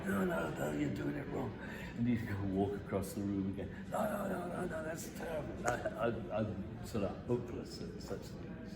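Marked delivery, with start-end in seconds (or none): 0.1-1.5 higher-pitched mock objection; imitating critics. Theatre people correcting the performer, mock exasperated. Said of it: "People go". 3.9-5.5 much higher mock dismay; imitating critics. Theatre people rejecting the performer's walk, mock appalled. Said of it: "People go".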